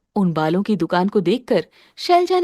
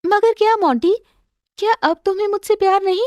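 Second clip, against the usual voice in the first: higher, hurt questioning voice, quick; hurt wife; 8 semitones higher than usual. Acted. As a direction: ask him in hurt disbelief. (आहत पत्नी का तेज़ ऊँचा सवाल)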